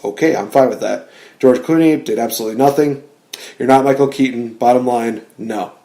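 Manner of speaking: normal talking voice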